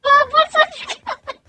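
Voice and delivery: baffled shout. Real, not acted. Baffled by the painter's term.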